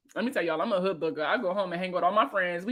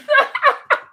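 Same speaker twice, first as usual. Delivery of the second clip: high burst of laughter; 8 dB louder than usual. Real, not acted. Laughing at chat's graduation year.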